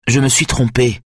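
forceful. A narrator's reading. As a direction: snap it out to interrupt. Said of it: "d'une voix forte".

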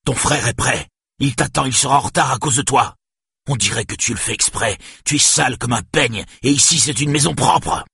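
high, heated parental tirade; yelling. A narrator's reading. Father raging that she makes her brother late, yelling. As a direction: scold with mounting exasperation. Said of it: "hurla-t-il".

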